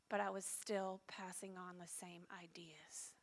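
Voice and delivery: hushed unvoiced admission; whispered realization. Real, not acted. Mother realizing she hurts her kids, whispered. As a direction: drop to a whisper on the hard truth.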